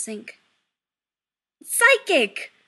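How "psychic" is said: high, loud outburst; exclaiming. A narrator's reading. Son correcting his mother about the potion, exclaiming. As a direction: exclaim it sharply and loudly. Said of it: "Chris exclaims".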